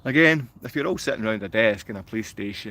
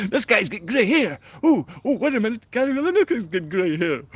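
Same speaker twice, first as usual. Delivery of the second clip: high squeaky parody voice; sneering high mock voice; mocking silly voice; 10 semitones higher, 4 dB louder than usual. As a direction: mimic the heckler in falsetto. Mocking viewers' comments.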